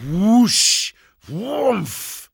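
loud, high whoosh and thump; imitated crash noises. A narrator's reading. Narrator voices the dune's collapse, high booming noises. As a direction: voice the rush, then the impact.

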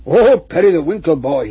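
loud, joyful call; crying out. A narrator's reading. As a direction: cry out the name gladly. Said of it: "he cried".

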